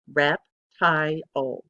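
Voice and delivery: Reptile drawn out very slowly, each beat separate; slow syllables. Shown as a demonstration. Teacher slowly sounding out reptile for the class. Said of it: "That actually has three syllables".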